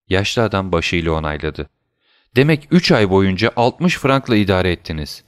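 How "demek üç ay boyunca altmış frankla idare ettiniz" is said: ordinary delivery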